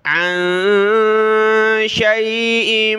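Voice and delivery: slow melodic chant; slow melodic Quran recitation. Shown as a demonstration. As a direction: recite like a qari, every sound stretched. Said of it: "full ayah recitation".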